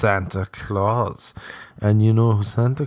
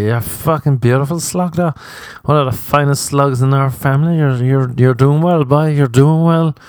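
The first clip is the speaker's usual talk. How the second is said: higher and louder, gushing Cork aunt; stupid aunt voice; 5 semitones higher, 4 dB louder than usual. Retold or imitated. Cork aunts praising a slug of a nephew, high and gushing. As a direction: coo "doing well, boy" over and over. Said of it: "doing that stupid voice".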